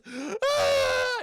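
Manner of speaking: high, loud captive shriek; imitated horror-film scream; mock terrified scream. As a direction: scream out long, hammed-up. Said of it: "She goes".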